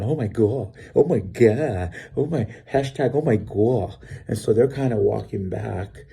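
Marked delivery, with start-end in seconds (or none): 0.0-4.0 put-on girlish panic, only slightly raised; mock freaking out. Man mimics frightened girls backing away, mock freak-out. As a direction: imitate teenagers panicking, playfully hammed-up. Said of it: "So they're freaking out".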